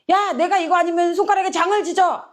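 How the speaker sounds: high, heated insistence; aggrieved vow. Retold or imitated. Wronged person swearing innocence, in acted indignation. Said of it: "억울할 때".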